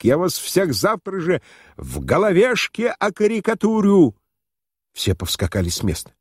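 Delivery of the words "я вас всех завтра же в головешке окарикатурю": high-pitched, indignant threat; shouted threat. A narrator's reading. Ejected journalist threatening all the guests, shouting. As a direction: cry out the threat with pitch raised. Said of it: "кричал сотрудник".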